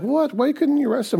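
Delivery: mock the leaders' outrage at the guards; indignant priests. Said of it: "and they're like".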